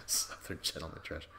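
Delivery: real amused laugh through the words. Real, not acted. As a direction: chuckle the phrase again under your breath.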